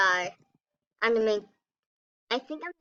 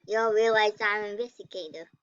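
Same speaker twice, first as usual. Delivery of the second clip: usual speaking voice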